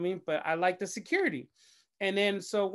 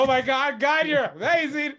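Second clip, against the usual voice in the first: grateful gush; 5 semitones higher, 4 dB louder than usual. Retold or imitated. Thanking God, crying.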